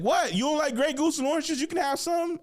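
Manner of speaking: offering his date a drink in mock surprise. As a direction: act shocked and generous.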